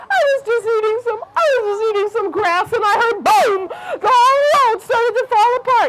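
high, squeaky-pitched panicked rabbit; frightened rabbit. A narrator's reading. Rabbit explaining to the lion, frightened high voice.

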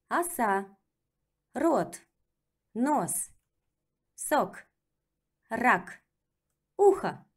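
second practice reading; repeat reading. Shown as a demonstration. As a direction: say it again for the learner. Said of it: "let's practice with reading".

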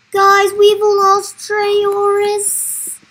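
high, very slow, loud lament; mock despairing wail. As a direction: wail it out, dragging every word. Announces the loss, mock panic.

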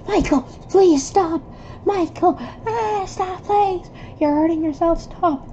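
desperate pleading. Acted. Friend pleading with Michael to stop hurting himself, high desperate cries. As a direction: beg him to stop.

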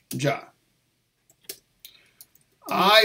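usual speaking voice

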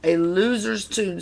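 understated villain's jeer; mild snarl. A narrator's reading. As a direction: sneer it lightly at a rival. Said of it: "snarled Stinky".

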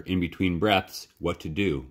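plain reading voice

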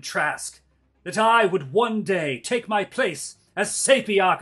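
loud villain monologue, rising to a shout; slow theatrical villain menace. Acted. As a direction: gloat like a scheming noble. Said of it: "give me a villain monologue".

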